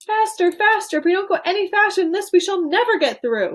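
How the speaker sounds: high, loud, rushed urging; excited urging. A narrator's reading. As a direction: hurry it on breathlessly. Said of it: "cried Mister Wonka".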